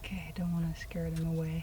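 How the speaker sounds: hushed whisper near wild birds; wildlife watching whisper. Real, not acted. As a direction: whisper so the birds stay put. Near pelicans, trying not to scare.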